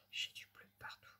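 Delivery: soft whispered aside; hushed mutter over the craft. Real, not acted. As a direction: whisper it to yourself while working.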